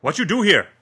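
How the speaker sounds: high, loud squealing shout; rodent-like squeal. A narrator's reading. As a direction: screech it like a cornered rat. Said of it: "the man shouts with an almost rodent-like squeal".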